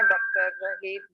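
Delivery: ordinary talk, nothing marked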